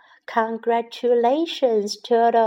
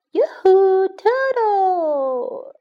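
high, loud call across the hill; 8 semitones higher, 6 dB louder, much slower than usual. Acted. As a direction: hail the turtle from far away, loud and high. Giraffe calls out to turtle.

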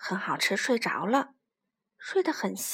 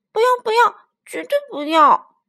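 high, loud "no, no"; childish loud refusal; 10 semitones higher, 9 dB louder than usual. Acted. Little dinosaur refuses the tyrannosaurus, shouting high. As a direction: stamp out the refusal like a stubborn child.

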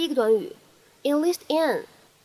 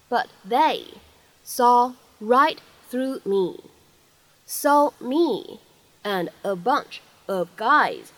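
slow, careful model reading of the line; teacher reading the model sentence slowly; much slower than usual. Shown as a demonstration. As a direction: read it out slowly for learners to copy. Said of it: "我们可以读成tried to".

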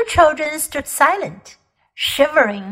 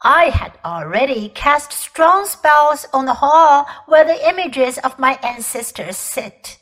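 commanding, louder queenly voice; proud imperious queen; 2 semitones higher, 6 dB louder than usual. Acted. Queen boasting to the children of her war, imperious. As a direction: declaim it like a cold, proud empress.

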